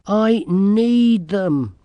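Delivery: high, loud and drawn-out insistence; insistent girl. A narrator's reading. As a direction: insist slowly and forcefully.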